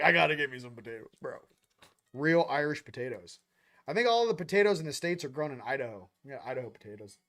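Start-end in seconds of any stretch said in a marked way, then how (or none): 0.0-1.4 eager quick quote. Retold or imitated. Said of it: "I was like".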